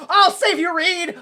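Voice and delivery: high, loud rescuer's call; hammed-up charge to the rescue; mock heroic shout. Ben rushing to save Reed, mock heroic shout.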